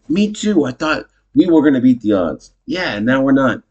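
high-pitched, emphatic agreeing; hammed-up amazed commiseration; acted eager agreement. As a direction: pile on the agreement, pitched right up. Acted couple agreeing they are over.

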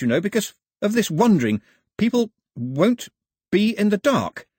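bluffing his way through the song; flustered Pilu. Acted. Pilu placating and translating.

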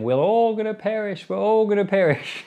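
high, panicky wail of doom; mock panic. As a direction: mimic a frightened crowd crying out. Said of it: "the people are saying".

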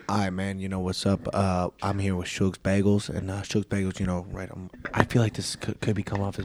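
lightly voiced shy athlete, barely above a murmur; imitating Seth. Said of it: "you talk as him".

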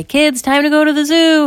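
loud, high parental call; calling the kids. Acted. Parents summoning kids for a zoo trip, calling out. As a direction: holler up to the children.

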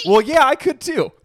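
high, loud comic exclamation; loud retold punchline. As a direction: blurt the comeback out high and loud. Retold punchline through laughter.

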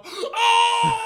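loud high cry of joy; yell. Retold or imitated. Greeting Michelle Obama, loud high joyful cry. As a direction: burst out at the sight of her. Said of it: "I yell".

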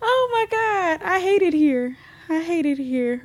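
whimpered comic despair; mock-crying misery. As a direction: moan it tearfully, half joking. Whining misery after laughing.

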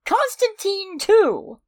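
high, slow, drawn-out announcement; excited reveal. Real, not acted. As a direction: stretch it out like a big reveal. Big reveal exclamation.

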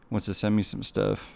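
ordinary talk, nothing marked

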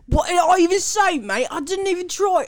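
high-pitched, protesting fake British accent; mock British accent. Accused sister protests she didn't offend, high mock-British. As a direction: protest innocence in a squeaky put-on British accent. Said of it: "to do my British accent".

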